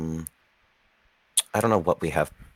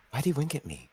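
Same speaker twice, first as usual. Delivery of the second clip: confused; 12 semitones higher, 4 dB quieter than usual. Acted. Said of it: "look over at Crane and go".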